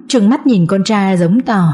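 ordinary delivery

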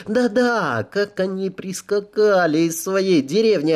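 high sneering mockery; mocking. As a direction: mimic them with a scornful lilt. Mocking the provincial couple.

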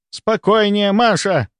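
high, stern scolding. A narrator's reading. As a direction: snap a trembling junior to order. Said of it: "прикрикнула на неё старшая".

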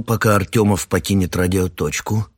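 ordinary delivery, nothing marked

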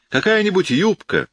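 like a man who has seen it all; knowing calm. A narrator's reading. Officer explaining to his aunt, knowing calm. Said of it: "со спокойствием многоопытного человека".

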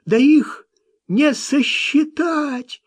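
high, slow terrified cry; frightened cry. A narrator's reading. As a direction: cry out in fear. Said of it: "в страхе крикнул Маккен".

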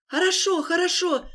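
high panicked wail. Acted. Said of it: "запричитал он".

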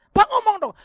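high, loud mock plea; retold urging. Friend urging the pastor to ask for help, high insistent plea. Friend urges him to speak up.

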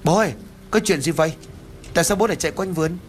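high, fast worried call to father. A narrator's reading. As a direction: shout across the yard to his father. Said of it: "rồi hô lớn". (gọi to, gấp gáp)

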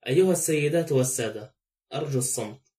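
plain reading voice

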